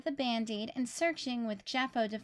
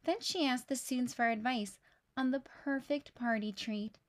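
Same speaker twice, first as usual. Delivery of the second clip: plain reading voice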